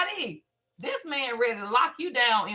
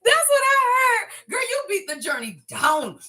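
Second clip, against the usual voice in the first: excited shout; 8 semitones higher, 5 dB louder than usual. Real, not acted. Hyped reaction to her own reading.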